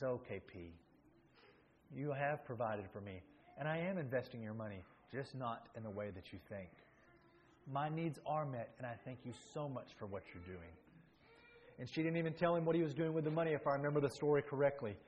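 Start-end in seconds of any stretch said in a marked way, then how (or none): 0.0-10.7 softer, lower, tender reassurance; gentle mother. Retold or imitated. Mother reassuring her son about his money, in a softer, lower, tender voice. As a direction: soothe him gently like a loving mother. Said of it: "gently smiles and just pats him".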